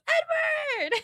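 high, drawn-out cry of a name; excited calling out. Retold or imitated. Imitating Margaret running to greet Edward, excited calling out. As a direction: call out a name high and delighted. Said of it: "Margaret goes".

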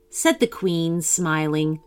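usual speaking voice

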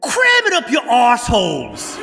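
loud, high defiant yell; defiant shout. Acted. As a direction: hurl the insult at them. Boy shouts insult at villain.

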